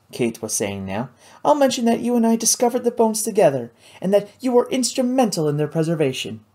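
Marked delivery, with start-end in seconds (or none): 1.4-6.5 put-on higher, eager young lady explaining; a lively, clever girl sharing her knowledge; Kate's voice. Kate plans her articles.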